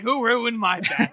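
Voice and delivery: comic outrage, words stretched out; mock upset. Playing old man whose bath is ruined, mock upset. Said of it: "That's actually why he's upset".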